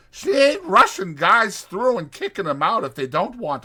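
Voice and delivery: chatty ranch hand selling a night in town; Whit's voice. Acted. Whit describing Susie's place.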